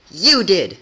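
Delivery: high, loud accusing yell; accusing shout. Acted. Rebel blaming Twilight, accusing yell. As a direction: throw the blame back at her.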